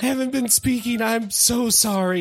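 high, tearful crying voice. Acted. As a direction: sob it out, overwhelmed. Tearful mother-daughter reunion.

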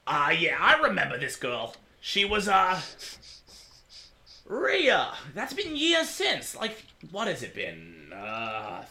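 put-on lightly rasped old tea shop owner; an old man remembering fondly, a touch of rasp; raspy old man. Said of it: "I'm gonna add more rasp to his voice".

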